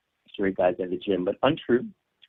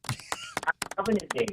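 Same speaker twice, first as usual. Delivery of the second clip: over an electric razor; 9 semitones higher than usual. Real, not acted. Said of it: "this sounds like a long-distance call".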